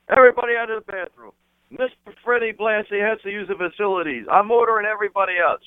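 high, fast crowd-clearing order; mock official announcement. Friend clearing the bathroom for Freddie, high-pitched official order. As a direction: call it out like an officer taking charge.

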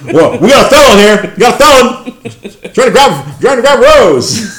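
high, loud laughing cop callout; cop shouting. Retold or imitated. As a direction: play the arresting cop. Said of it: "handcuffs, on the ground".

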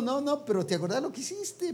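high, nagging accusing voice; accusing reminder. Retold or imitated. Pastor voicing church gossip, high nagging accusation. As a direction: needle them about past sins. Voicing people who dwell on sins.

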